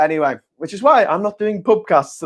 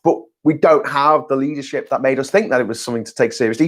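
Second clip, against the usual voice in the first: normal talking voice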